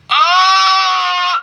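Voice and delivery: very high, loud fake shriek; jump-scare the viewers; sudden scream. Vlogger pranking viewers during the ritual, shrieking.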